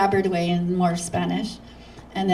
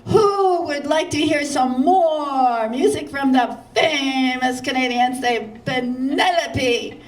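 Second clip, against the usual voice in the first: higher, slow showman announcement with a laugh at the end; hammed-up MC announcement; 5 semitones higher, 3 dB louder, slower than usual. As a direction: announce her like a host working up the crowd. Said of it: "he says".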